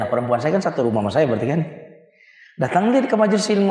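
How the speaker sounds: ordinary talk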